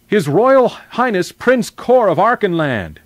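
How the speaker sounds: high, loud, slow ceremonial announcement; herald's proclamation. A narrator's reading. Voice from outside announces the prince, herald's proclamation. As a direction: proclaim it grandly like a royal herald.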